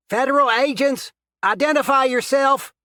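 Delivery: very loud, high commanding shout. A narrator's reading. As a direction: bark the police warning down the hall. Agent entering with weapon drawn.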